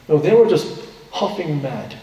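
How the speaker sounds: ordinary talk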